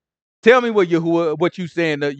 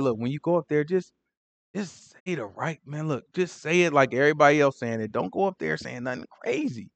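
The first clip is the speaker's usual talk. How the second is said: hushed coaching; 3 semitones lower, 10 dB quieter than usual. Retold or imitated. Messenger privately coaching Micaiah.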